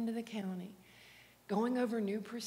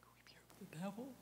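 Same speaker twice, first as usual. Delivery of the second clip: puzzled whisper; 14 dB quieter than usual. Retold or imitated.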